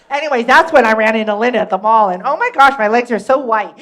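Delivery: put-on fast, loud gushing teen chatter; rambling teenage girl. Teenage girl rambling to Cindy about the mall, loud and fast. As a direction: keep the gossip pouring out without pause.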